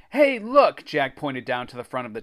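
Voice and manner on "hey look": high, loud call for attention; excited call. A narrator's reading. Jack spots a bag of gummies, excited call. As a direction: call out a sudden find.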